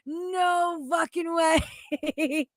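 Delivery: drawn-out, shouted disbelief, slowed right down; slow stunned disbelief. Real, not acted. Reacting to a claim in a video, slow shouted disbelief. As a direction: stretch each word out, too stunned to believe it.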